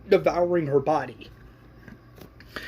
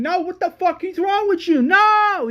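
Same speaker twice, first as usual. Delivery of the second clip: high-pitched outraged yelling; acted scream; 6 dB louder than usual. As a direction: scream in disbelief at him. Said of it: "And John screaming".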